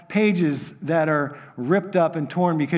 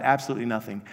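ordinary delivery

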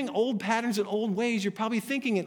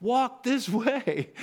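laughing quote; 4 semitones higher, much slower than usual. Real, not acted. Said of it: "Isn't that a song from the seventies".

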